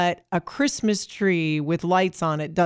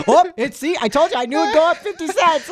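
high-pitched, louder mock grumbling; hammed-up impression of an agitated colleague; imitating co-host; 10 semitones higher, 5 dB louder than usual. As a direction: mimic him fussing that he called it. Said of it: "He's like".

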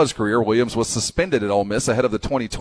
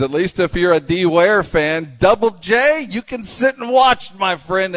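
high, slow gloating tease; 7 semitones higher, 5 dB louder, slower than usual. Real, not acted. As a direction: rub it in with glee. Gloating to D Ware fans.

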